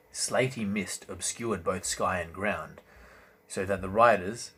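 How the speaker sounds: ordinary delivery, nothing marked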